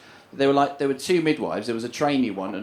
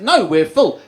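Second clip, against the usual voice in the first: curt refusal; 5 semitones higher, 7 dB louder, slower than usual. Retold or imitated. Hospital curtly refuses them.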